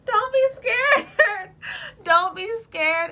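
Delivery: high, loud words through laughter; laughing. Real, not acted. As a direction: laugh it out while you reassure. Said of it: "I'm laughing 'cause I can relate".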